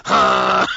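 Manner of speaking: high, loud honking goose noise. Retold or imitated. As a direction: honk like a goose for a laugh. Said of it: "I was geese".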